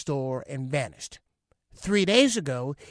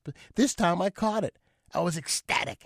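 ordinary delivery

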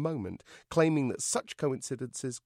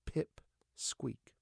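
hushed, voiceless whisper; soft, unvoiced letdown; deflated whisper; 3 semitones higher, 7 dB quieter than usual. A narrator's reading. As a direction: whisper the dreaded name. Dreaded name on the cake.

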